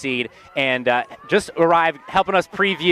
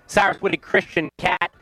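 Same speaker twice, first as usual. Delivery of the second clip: higher, energetic broadcast welcome; radio announcer; 5 semitones higher than usual. Acted. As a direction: open the show like a live radio host. Host reopens live broadcast.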